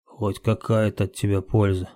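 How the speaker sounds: usual speaking voice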